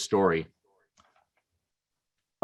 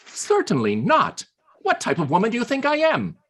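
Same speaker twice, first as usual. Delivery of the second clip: higher, indignant rising protest; offended woman; 10 semitones higher than usual. Acted. Woman indignantly refusing Churchill's cheap offer, pitched higher. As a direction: bristle with mock offence.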